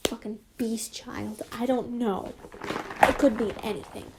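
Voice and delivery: ordinary delivery, nothing marked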